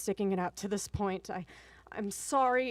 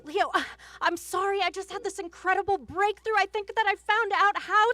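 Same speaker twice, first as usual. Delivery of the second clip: high, loud, urgent excuse; excited defensiveness; 9 semitones higher, 8 dB louder than usual. Acted. Wife explaining her breakthrough to Leo, excited defensiveness. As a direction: gush out the excuse at full pitch. Defends lateness to angry husband.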